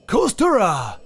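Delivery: imitating a Japanese pronunciation with a vowel added; mock Japanese loanword; mock Japanese-style word. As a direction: say Kostura with a high, foreign-sounding lilt. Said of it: "I don't know, um".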